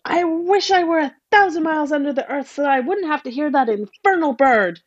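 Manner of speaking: fast distraught plea; wailing mother. A narrator's reading. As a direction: wail it in dread. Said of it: "The mother wailed".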